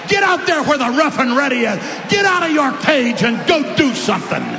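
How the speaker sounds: shouted, high, loud preaching exhortation; rally the church like a battle cry; preacher thundering at the congregation to go out; full-force preaching. Real, not acted.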